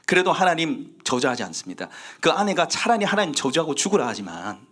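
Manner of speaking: ordinary talk, nothing marked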